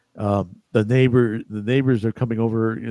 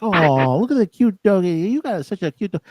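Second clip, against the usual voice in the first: high, cooing mock gush; gushing admirer; 9 semitones higher, 4 dB louder than usual. Woman admiring a stranger's cute dog, gushing. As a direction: coo over a dog in a much higher voice.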